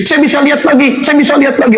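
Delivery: high excited exclamation; overjoyed cry. Retold or imitated. Healed Egyptian man telling the crowd he sees, high and excited. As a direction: shout it in disbelieving joy. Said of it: "Bahasa dialek Mesir".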